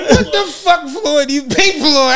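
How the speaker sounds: high, laughing ribbing; laughing mock. Mocking Rob's confusion about Floyd, laughing. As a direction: tease him through laughter.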